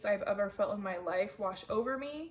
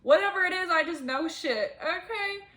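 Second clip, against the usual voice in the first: tearful insistence; 7 semitones higher, 5 dB louder than usual. Real, not acted. Emotional insistence about being psychic.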